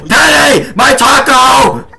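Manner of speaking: high, loud panicked shouting; frantic shout. Pretend. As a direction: scream it in panic.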